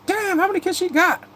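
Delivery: very high-pitched incredulous outburst; comic amazement. Acted. Streamer reacts to another new baby in a show, high-pitched amazement. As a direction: squeal it high in mock astonishment.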